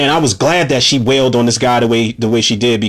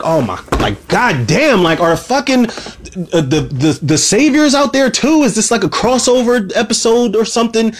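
rising to a much higher, disbelieving exclamation; mock disbelief; 9 semitones higher than usual. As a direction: act out shocked disbelief at the flashlights. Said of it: "I'm like".

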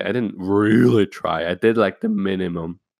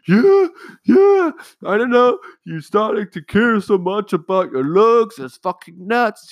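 shrill, much higher hammed-up mom shriek; mocking mom; 13 semitones higher, 6 dB louder than usual. Mom horrified by son's eyebrow cut, mock shrieking. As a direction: parody a horrified mother. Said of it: "My mom was like".

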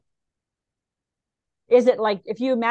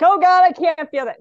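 hammed-up panic, higher and louder; mock dread at feeling anger; 6 semitones higher, 8 dB louder than usual. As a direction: playact someone refusing to feel. Acting out panicked avoidance.

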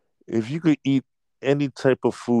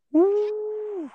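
high cheering woo; excited whoop. Real, not acted. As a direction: whoop with delight. Cheering the logo idea.

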